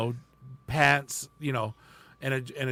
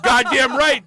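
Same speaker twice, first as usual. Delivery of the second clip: laughing yell; 9 semitones higher, 8 dB louder, slower than usual. Retold or imitated. Retold eager answer, laughing.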